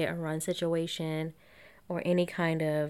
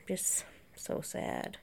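whispered sadness; 11 dB quieter, slower than usual. Real, not acted. Grieving over the victim.

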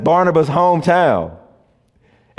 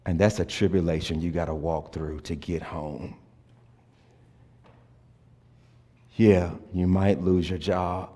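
tender preaching; 8 semitones lower, 8 dB quieter than usual. Acted. Pastor naming the congregation's hardships, soft and tender. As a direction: speak each hardship gently, with long pauses.

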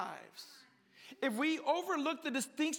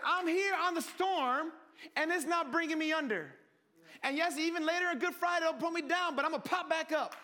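high, forceful preaching as Jesus; triumphant declaration; 4 semitones higher than usual. Acted. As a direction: proclaim it defiantly, pitch raised. Voices Jesus defying the storm.

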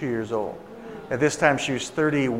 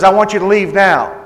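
loud, raised-pitch order to leave; hostile dismissal; 4 semitones higher, 8 dB louder than usual. Retold or imitated. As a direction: imitate an angry woman throwing guests out. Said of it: "That's how she was talking".

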